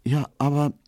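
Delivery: hesitant, high-pitched objection; halting, unsure start; faltering. A narrator's reading. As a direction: break off uncertainly after two words. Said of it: "meinte sie stockend".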